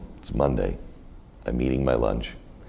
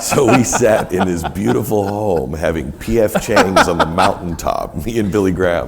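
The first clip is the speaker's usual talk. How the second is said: laughing through it; 5 semitones higher, 8 dB louder than usual. Real, not acted. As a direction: tell it while still laughing. Still laughing over P F Chang's.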